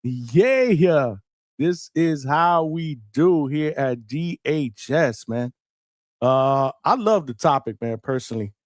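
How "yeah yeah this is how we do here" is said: hyped-up shout to open the show; big excited yell; hype shout. Real, not acted. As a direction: shout it out to fire up the crowd. Host hypes show opening.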